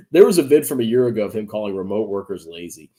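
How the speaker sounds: ordinary talk, nothing marked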